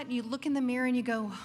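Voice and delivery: ordinary talk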